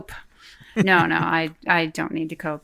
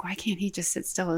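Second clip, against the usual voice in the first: whispering; 9 dB quieter than usual. Retold or imitated. Retold irritated inner thought.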